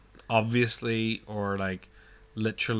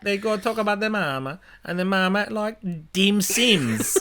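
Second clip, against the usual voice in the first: mock Italian accent; 8 semitones higher than usual. Shown as a demonstration. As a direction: ham up the Italian mama talk. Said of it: "keep it Italian".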